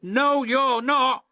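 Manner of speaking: high, slow, loud firm reassurance; puffing James. Acted. James reassuring Thomas, high loud firm voice. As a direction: stop his friend's worry. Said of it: "puffed James".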